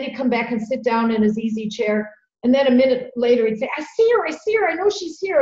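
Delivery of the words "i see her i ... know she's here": much higher, trembling panicked repeat; retold shaky panic, lightly done; wobbly frightened voice. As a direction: imitate the scared uncle's wobbling plea. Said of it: "His voice was wobbly".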